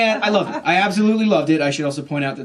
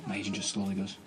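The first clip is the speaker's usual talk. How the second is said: normal talking voice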